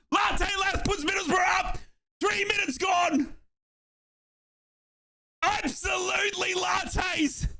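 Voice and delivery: high, ringing celebratory commentary; elated goal call. Real, not acted. Commentator announces Middlesbrough are ahead, elated. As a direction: keep the celebration up, pitched high.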